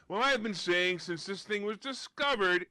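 ordinary talk, nothing marked

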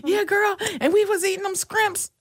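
high-pitched gossiping mock voice; scrimps-saying girlfriend. Mimicking somebody who says scrimps, high sassy gossip voice. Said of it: "Every time somebody".

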